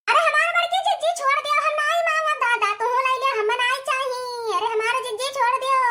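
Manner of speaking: high, fast begging cry; frantic plea while being held by the hair; pleading wail. Acted. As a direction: beg her to let go, fast and high. Begging to be let go.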